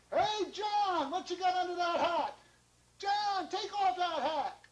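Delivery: very high, loud taunting shout; jeering kids. Acted. Kids in the streets taunt John, jeering. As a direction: yell like children teasing across a street.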